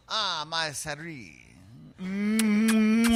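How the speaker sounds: slow, drawn-out hammed French accent; mock French lover, lingering with a long hum. Shown as a demonstration. Asked for his best French accent, slow hammed French. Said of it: "best French accent".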